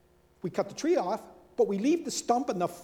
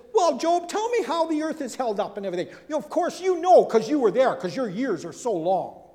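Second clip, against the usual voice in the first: higher, louder, teasing sarcasm; sarcastic God; 5 semitones higher, 6 dB louder than usual. Acted. God answers Job with sarcasm, higher and teasing. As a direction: needle him with mock questions. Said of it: "God uses sarcasm to Job".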